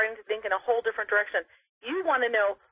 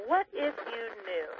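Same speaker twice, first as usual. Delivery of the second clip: slow emphatic demo; 5 dB quieter, slower than usual. Shown as a demonstration. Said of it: "Much more powerful".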